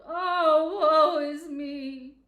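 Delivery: loud, high, slow ghostly wail; wailing lament. Acted. Marley's ghost bewailing his fate, loud slow wail. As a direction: stretch out the moan like a doomed spirit.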